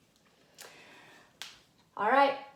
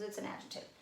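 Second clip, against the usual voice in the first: whisper; 14 dB quieter, much faster than usual. Acted. Whispered aside to class.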